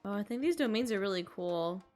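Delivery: ordinary talk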